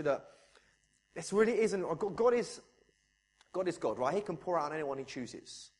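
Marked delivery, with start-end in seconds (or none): none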